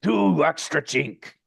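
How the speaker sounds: name the price slowly as a put-out gargoyle. Acted. Gargoyle names the cleanup price.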